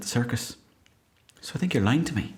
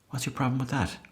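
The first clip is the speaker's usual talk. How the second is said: normal talking voice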